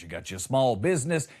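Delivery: ordinary talk, nothing marked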